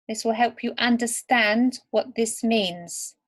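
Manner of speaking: normal talking voice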